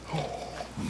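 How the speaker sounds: unvoiced sharp intake of breath; soft gasp. Storyteller gasping for children, breathy gasp. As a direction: gasp in mock surprise.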